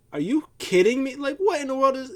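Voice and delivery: high, loud disbelief; mock outraged astonishment; incredulous outburst. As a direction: burst out as if appalled, acted out. Acting out Heyman's disbelief.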